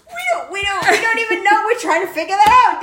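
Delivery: high, laughing shout; laughing dad. Retold or imitated. Dad laughing as he answers son on phone. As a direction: answer while cracking up.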